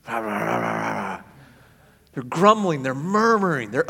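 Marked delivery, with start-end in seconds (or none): 0.0-1.2 Fred Flintstone grumble. Retold or imitated. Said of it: "Sound like Fred Flintstone".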